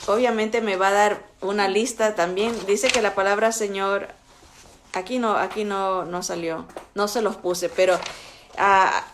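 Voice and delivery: normal talking voice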